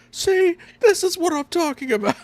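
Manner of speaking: mock outrage. Retelling the protester proving his point, mock outrage. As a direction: imitate a vindicated protester, voice shooting way up. Protester's indignant reply, acted.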